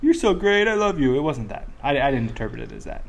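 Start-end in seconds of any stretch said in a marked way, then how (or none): 0.0-1.2 higher, mock sweet gushing. Mocking a touchy-feely coach with higher, gushing praise. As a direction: gush over the kid like a sappy coach. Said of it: "touchy-feely".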